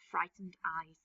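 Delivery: plain reading voice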